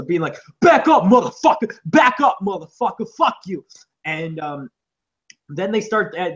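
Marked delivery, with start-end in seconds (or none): 0.6-3.6 retold screaming fight. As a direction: yell it like two guys squaring off. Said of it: "they're screaming at each other".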